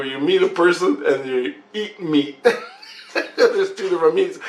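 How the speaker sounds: high-pitched, amused explanation; real laughing. Real, not acted. Dad recounts the argument about meat, laughing. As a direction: explain it while breaking into laughter.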